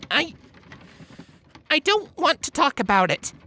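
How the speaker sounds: put-on high, strained girlish voice; pleading young mare voice. A narrator's reading. Twilight refusing, shaken.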